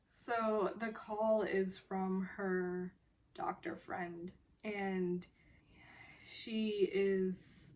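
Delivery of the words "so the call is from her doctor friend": usual speaking voice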